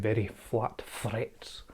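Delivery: slow, lightly done Scottish accent. Shown as a demonstration. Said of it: "Hear my Scottish accent".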